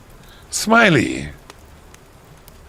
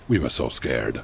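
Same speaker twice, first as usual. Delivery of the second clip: usual speaking voice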